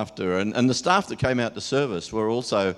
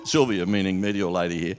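ordinary talk, nothing marked

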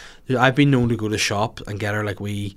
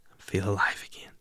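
whispered; 2 semitones lower, 8 dB quieter than usual. Real, not acted. Exhausted aside.

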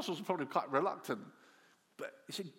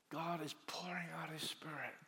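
whisper; 8 dB quieter than usual. Real, not acted. Preacher drops to a whisper.

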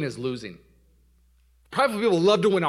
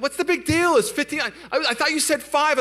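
high-pitched, incredulous wife voice; arguing wife imitation; 11 semitones higher than usual. Wife brushing off her husband's complaint, imitated high protest. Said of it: "we're just yelling and talking very passionately".

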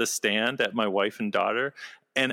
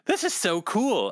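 excited; 9 semitones higher than usual. Retold or imitated. Retold awed remark.

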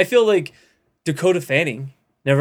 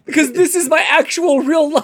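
mock child voice; 11 semitones higher, 4 dB louder than usual.

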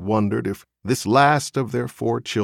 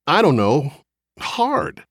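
higher, halting reply after a long breath; haltingly searching for words; struggling to explain; 6 semitones higher, 3 dB louder than usual. A narrator's reading. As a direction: grope for the word, pitched up. Husband struggles to explain himself.

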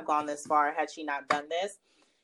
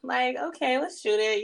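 unimpressed photographer; 9 semitones higher, slower than usual. Retold or imitated. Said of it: "I'm not really feeling it".

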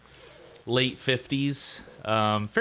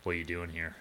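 low menacing question; 5 semitones lower, 10 dB quieter, faster than usual. Acted. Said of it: "and he's like".

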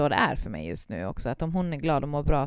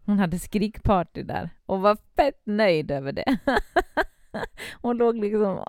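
ordinary talk, nothing marked

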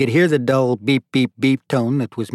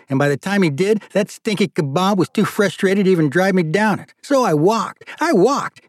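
put-on much higher, complaining older brother; exasperated rant; 7 semitones higher, 3 dB louder than usual. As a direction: whine about the long wet walk.

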